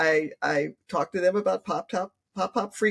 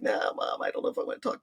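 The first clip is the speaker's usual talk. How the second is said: voiceless, much softer reluctant daughter; whispered, reluctant brush-off to mom; reluctant mumble; 5 dB quieter than usual. Retold or imitated. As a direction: murmur it under your breath like a teen dodging the topic. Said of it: "And the other one's like".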